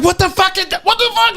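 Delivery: high, shocked yelp of fright; startled yell. Retold or imitated. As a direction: yelp like he just saw a possum. Startled husband jumping up.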